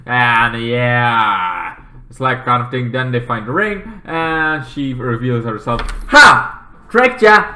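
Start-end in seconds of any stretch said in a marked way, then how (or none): 6.1-7.5 loud, high triumphant taunt; gloating villain. Retold or imitated. Sister revealing her trick, loud gloating crow.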